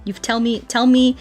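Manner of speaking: ordinary delivery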